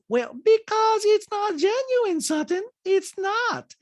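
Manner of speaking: high, slow put-on voice; Diana. Diana telling Sutton it's not genuine, high mock impression. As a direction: mimic her insisting, stretched up high. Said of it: "And Diana goes".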